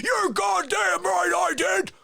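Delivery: high, drawn-out angry shout; furious outburst. Acted. Colonel confessing to the lawyer, enraged shout. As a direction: roar the confession back at him.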